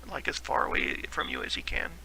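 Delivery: ordinary talk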